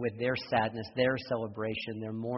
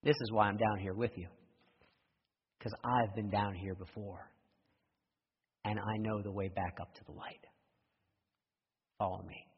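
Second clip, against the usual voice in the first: softer, lower, steady and kind; calm reassurance; 4 semitones lower, 4 dB quieter than usual. Retold or imitated. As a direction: gently promise the way out. Friend calmly reassures man.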